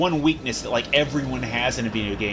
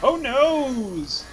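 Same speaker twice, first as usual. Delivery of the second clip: playful high "oh noes"; hammed-up alarm at the game; mock dismay; 12 semitones higher than usual. Gates sealing in game.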